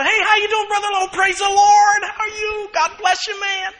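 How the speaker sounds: high, loud, over-bright hallway greeting; a loud, phony hello; fake cheery church greeting. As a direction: ham up a churchgoer's glad-handing.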